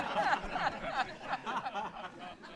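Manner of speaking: soft high laugh; airy laugh. Real, not acted. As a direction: let out a quiet high laugh. Laughs at the reply.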